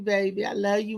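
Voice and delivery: ordinary talk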